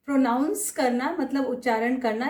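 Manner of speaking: usual speaking voice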